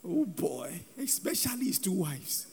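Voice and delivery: hushed afterthought; wry quiet aside. Real, not acted. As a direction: drop it low, as if musing to yourself. Wry aside repeating his last point.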